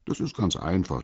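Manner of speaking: usual speaking voice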